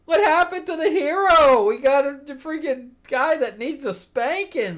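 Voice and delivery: high-pitched, indignant disbelief; real appalled outburst; exasperated complaint. Real, not acted. Incredulous complaint about the hero.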